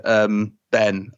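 ordinary talk, nothing marked

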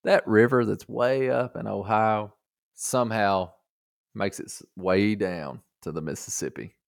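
ordinary talk, nothing marked

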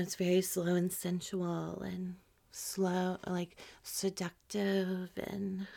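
slow, hushed seductive voice; slow and sensual. Shown as a demonstration. Explaining how foreplay should sound, slow and seductive. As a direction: soften right down and stretch words sensually. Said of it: "you start with foreplay, and it's".

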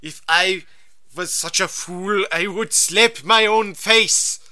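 put-on slow, flat German-accented quote; a hint of German accent, slow and deliberate; Herzog impression. Quoting the German director in a slow German accent. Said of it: "one thing he said".

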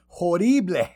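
over-the-top Cajun mockery; exaggerated mock Cajun accent. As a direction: ham up the bad Cajun "ho-DEE-blay". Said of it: "Cajun accent".

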